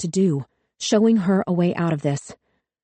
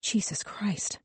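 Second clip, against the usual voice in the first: hushed, much softer gasped oath; whisper; 3 semitones higher, 10 dB quieter, slower than usual. A narrator's reading. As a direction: breathe it in shock. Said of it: "Someone whispered".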